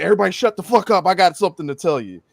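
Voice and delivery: loud, fast mock bark of a rude guy; mock barging yell. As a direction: barge in and shout everyone down. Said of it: "and be like".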